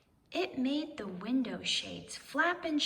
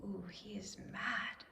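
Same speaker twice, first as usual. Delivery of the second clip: whispered aside; 3 semitones lower, 9 dB quieter than usual. A narrator's reading. Narrator's aside to listener.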